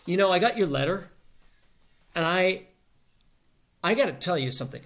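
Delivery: worked-up confrontation, in quick loud bursts; acted confrontation. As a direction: launch into it forcefully, halting between quick bursts. Demonstrates launching into a monologue.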